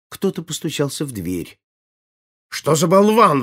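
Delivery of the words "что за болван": loud, high outburst of irritation; angry exclamation. A narrator's reading. Furious prince at a knock on the door, loud irritated outburst. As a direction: snap loudly, forgetting all manners. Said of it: "воскликнул он, забыв даже о приличиях".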